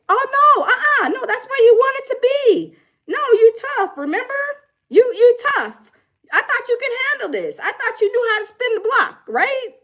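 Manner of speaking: high, teasing sing-song jab; mock taunting. Mocking a scared wannabe tough kid, teasing and high. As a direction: rub it in, playfully.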